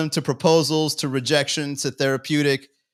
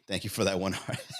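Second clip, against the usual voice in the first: laughing thanks; 6 semitones lower, 8 dB quieter than usual. Real, not acted. Laughs at a single heart.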